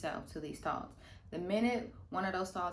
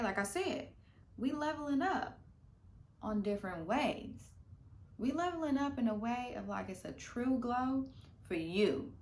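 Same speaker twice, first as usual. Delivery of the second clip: ordinary delivery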